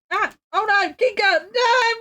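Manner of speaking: high, wailing cry of protest; real high-pitched panic at a small mishap; dismayed outcry. Real, not acted. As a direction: cry out as the pet wrecks it. Cat knocks pencil tips out.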